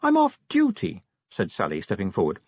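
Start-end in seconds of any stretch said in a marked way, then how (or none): 0.0-1.0 put-on high, breezy young woman voice; chatty, light-hearted vampire girl. Sally voiced.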